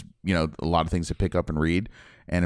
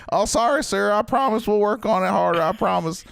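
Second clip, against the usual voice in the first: mock-apologetic Southern yokel, much higher and louder; Southern accent; 14 semitones higher, 3 dB louder than usual. Locals apologising to a Nazi soldier, in a high Southern accent. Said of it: "And they're like".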